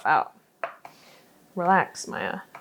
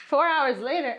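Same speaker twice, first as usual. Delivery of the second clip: higher, louder joking time-skip announcement; mock announcer; 6 semitones higher, 3 dB louder than usual. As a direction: announce the time jump like a TV narrator. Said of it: "I'm just joking".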